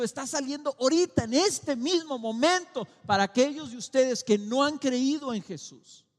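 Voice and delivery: predicando fuerte y agudo; proclámalo con fervor a toda voz; predicación intensa. Acted. Preaches at full force. (predicación a toda voz)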